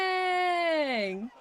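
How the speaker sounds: high, long-held "dang" sliding down; drawn-out high whoop of amazement; impressed exclamation. Real, not acted. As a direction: stretch out the word, high then falling. Reacting to big gift.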